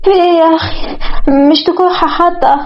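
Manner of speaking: startled. Acted. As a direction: jump with fright and protest. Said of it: "اتخضت مروة وقالت".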